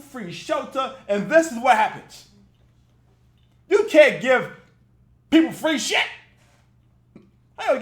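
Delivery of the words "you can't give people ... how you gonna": louder, hammering each word; a loud, exasperated rant at the mayor. Real, not acted. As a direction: drive the point home loudly. Ranting at the mayor.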